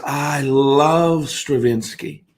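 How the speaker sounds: very slow, drawn-out adoration; imitated gushing. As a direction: stretch LOVE out as if swooning. Acts old lady gushing over composer.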